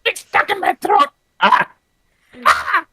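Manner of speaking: high, choked squawk of a creature; straining character voice. Acted. Player hunts for Creek's voice, high and strangled.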